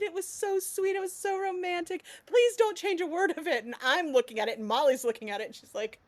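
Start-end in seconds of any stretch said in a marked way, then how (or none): 0.0-3.6 high-pitched fan gush; gushing praise. Retold or imitated. Author quoting readers who adore the draft, gushing. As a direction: gush sweetly in a higher voice.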